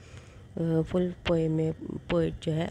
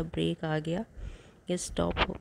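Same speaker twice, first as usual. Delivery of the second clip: usual speaking voice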